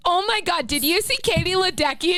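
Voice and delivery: high, breathless-with-hype imitation; mock gushing fan. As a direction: gush it high like a bandwagon fan. Mimicking sudden Olympic swimming fans.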